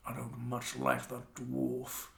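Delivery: slow, low-voiced dislike; grumbling mage. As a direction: lean in and grumble slowly. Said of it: "he leans forward and goes".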